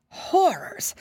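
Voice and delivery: breathless, high whisper of dread; whispered horror. A narrator's reading. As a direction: gasp it out in a horrified hush. Narrator's dramatic exclamation.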